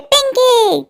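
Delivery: high, drawn-out call falling at the end; calling out sharply. Acted. Mother calling out her daughter's name, sharply reproachful.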